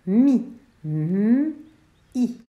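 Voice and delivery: teacher segmenting the syllable mi; sounding out letters. Shown as a demonstration. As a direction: split mi into its letters. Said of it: "puis la deuxième syllabe".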